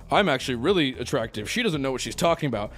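mock copium. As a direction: fake confidence to shrug off the insult. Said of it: "Copium would be me saying".

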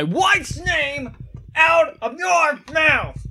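high, slow shouted threat; mock angry shout. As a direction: bellow it like a furious husband. Will Smith quote joke.

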